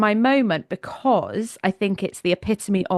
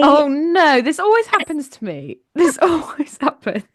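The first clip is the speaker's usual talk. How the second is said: flustered laughing; 7 semitones higher, 3 dB louder than usual. Real, not acted. Flustered at being cut off.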